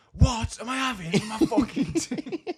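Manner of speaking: high, loud, slow mock-furious yell; blowing up. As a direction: explode in an imitated outburst, drawing it out. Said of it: "that blew up again".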